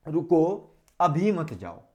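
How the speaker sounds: रुको alone, a clear break, then अभी मत जाओ together; pause after रुको. Shown as a demonstration. Teacher showing where the comma falls, pausing after रुको. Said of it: "और हमने लगा दिया अल्प विराम".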